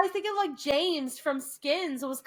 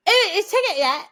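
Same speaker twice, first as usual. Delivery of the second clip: loud, high, slurred English-accent mimicry; garbled accent imitation; 5 semitones higher, 8 dB louder than usual. Friend back from England asks about a ticket, garbled accent. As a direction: blurt it in an unintelligible English accent. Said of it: "it literally came out".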